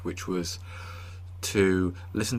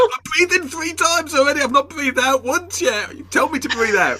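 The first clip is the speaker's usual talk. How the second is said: mock breathless panic; 5 dB louder than usual. Guest acts himself pleading with the breathing tape, high and desperate. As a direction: beg for permission to exhale. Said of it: "I was like".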